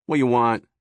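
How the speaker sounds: rough New Jersey demand; New Jersey gruff. A narrator's reading. Jimmy snaps at his wife in New Jersey accent. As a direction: bark it, New Jersey tough guy. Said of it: "thick New Jersey accent".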